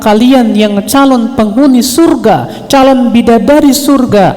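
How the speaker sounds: high, insistent pulpit voice; forceful preaching. Real, not acted. Challenges the congregation, preaching at full force. (berdakwah dengan suara tinggi dan menggebu-gebu)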